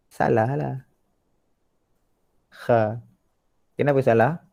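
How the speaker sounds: ordinary delivery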